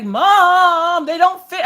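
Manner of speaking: high complaint of mom they don't fit; whiny son. Retold or imitated. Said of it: "He's like".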